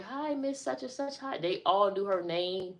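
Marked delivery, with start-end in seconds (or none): 0.0-1.4 put-on high, bright little-girl greeting; imitating a little girl calling hello; niece's greeting. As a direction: chirp it like a happy four-year-old. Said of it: "She's like".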